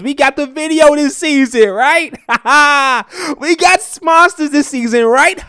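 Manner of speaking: high, loud, laughing hype; excited hype. Real, not acted. Host boasts to fans of the new season, excited hype.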